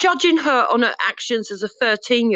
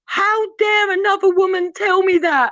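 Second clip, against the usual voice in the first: higher-pitched imitation of an outraged woman; mock indignation; 8 semitones higher than usual. Critic mimics young woman offended by another woman's advice, mock indignation.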